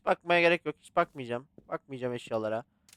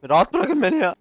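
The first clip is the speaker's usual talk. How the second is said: exasperated yell; 10 semitones higher, 7 dB louder than usual. Real, not acted. Mobbed by characters.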